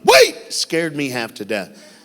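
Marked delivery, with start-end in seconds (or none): 0.0-0.3 sudden high shout across the gym; kid yelling. Retold or imitated. Kid in the bleachers shouting to the speaker, loud yell. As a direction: yell it out to stop him leaving. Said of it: "And a kid yelled".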